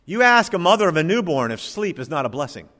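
usual speaking voice

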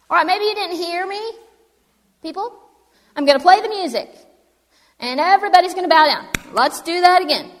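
high, loud proclamation; king commanding a crowd. Acted. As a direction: call out over a crowd as an irked ruler.

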